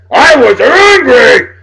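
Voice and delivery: high, loud, slow drawn-out angry roar; angry shout. Shown as a demonstration. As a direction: explode with the word angry, stretching it out.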